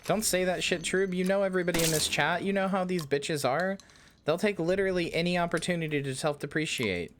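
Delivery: high-pitched, emphatic protest; indignant reassurance. Real, not acted. Streamer scolding chat for doubting they matter, indignant.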